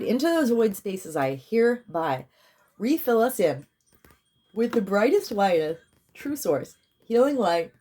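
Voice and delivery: rising, fervent ritual declaration; healing invocation. Real, not acted. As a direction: call in the light with fervor. Spiritual healing decree.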